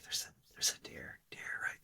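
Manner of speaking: hushed, urgent-sounding whisper. Retold or imitated. Child whispers to Dad while out hunting, whispering. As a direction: whisper it under your breath. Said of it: "I whisper to Dad".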